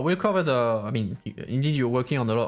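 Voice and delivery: ordinary delivery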